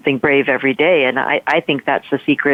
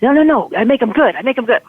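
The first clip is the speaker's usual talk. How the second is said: lightly acted, higher-pitched teenage insistence; insistent correction; 7 semitones higher, faster than usual. Boy explaining his slang to driver, insistent correction. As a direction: hurry to clear up the misunderstanding, pitched up.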